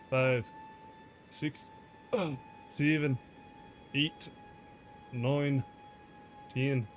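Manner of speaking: put-on Scottish-style accent held through counting and chat. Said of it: "That was a weird start to the accent".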